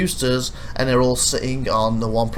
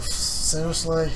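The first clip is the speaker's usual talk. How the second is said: disbelief; 4 semitones higher, 4 dB quieter, much slower than usual. Retold or imitated. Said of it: "I was like".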